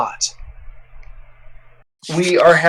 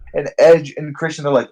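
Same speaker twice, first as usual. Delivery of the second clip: usual speaking voice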